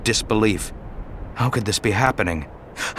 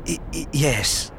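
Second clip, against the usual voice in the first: soft, higher, halting wounded voice; faint stammer; 5 semitones higher, 5 dB quieter than usual. Acted. Dying Obi-Wan stammers to Anakin, soft and halting. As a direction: stammer weakly.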